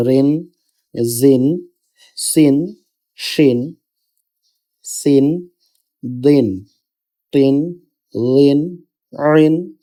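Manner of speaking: each syllable alone with an "ইন" ending, Arabic letter sounds; careful Qaida drill, every syllable closing on a held "ইন"; double-zer drill. Shown as a demonstration. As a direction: recite "ইন, বিন, তিন" style syllables one by one, evenly paused. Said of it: "দুইটা জেরের উচ্চারণ হবে ইন".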